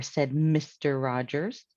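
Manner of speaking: usual speaking voice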